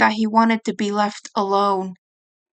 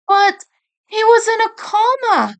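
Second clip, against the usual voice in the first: much higher, louder cut-in; urgent interruption; 12 semitones higher, 5 dB louder than usual. Acted. Mikey cutting Raph off, high and urgent. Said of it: "Mikey interrupted".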